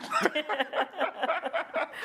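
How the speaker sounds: a high, delighted burst of laughter. Real, not acted. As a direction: laugh at your own joke. Laughing at own blaming joke.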